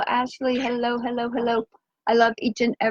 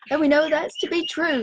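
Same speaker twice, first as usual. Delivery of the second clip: ordinary talk, nothing marked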